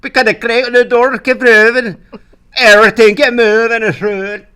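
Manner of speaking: put-on loud, garbled Scottish accent, barely understandable; mock Scottish accent. Retelling the audition to a friend, loud mock Scottish accent. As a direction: bark it in a thick mangled Scottish brogue. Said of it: "I had to do a Scottish accent".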